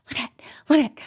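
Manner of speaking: whispered calling of a name; urgent whisper. Retold or imitated. Crawling to her room, whispering for Lynette. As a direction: hiss the name into the dark.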